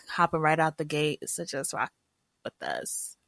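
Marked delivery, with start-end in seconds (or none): 1.3-3.2 unvoiced low hush; whisper. Real, not acted. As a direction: let it fade into a whisper. Ends in a whisper.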